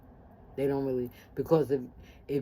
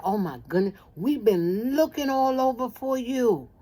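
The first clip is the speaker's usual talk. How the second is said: high, drawn-out phone exclamation; retold excited relief; 9 semitones higher, 6 dB louder than usual. Relative finally reaching missing Sharon on phone, excited relief. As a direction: act out an overjoyed relative, loud and stretched.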